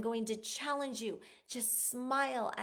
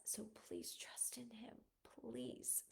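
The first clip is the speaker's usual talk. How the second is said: soft pleading whisper, much softer; earnest whisper; 17 dB quieter, slower than usual. Real, not acted. Host to podcast listeners, begging them to trust God, whispered. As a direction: breathe the plea almost silently.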